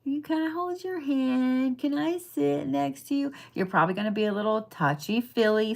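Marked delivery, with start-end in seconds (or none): 0.0-3.3 much higher, softer, pleading mock voice; clingy Scorpio. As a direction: plead like a cuddly child. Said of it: "gonna be kind of like".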